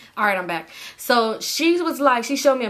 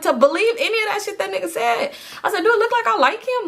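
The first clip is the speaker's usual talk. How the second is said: high-pitched incredulous protest; indignant retort; 12 semitones higher, 3 dB louder than usual. Retold or imitated. Acted indignant retort to friend.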